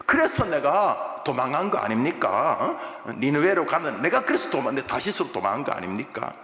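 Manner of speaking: normal talking voice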